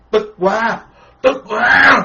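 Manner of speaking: mock anger. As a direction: spit out the frustration in rage. Monk voicing furious donor.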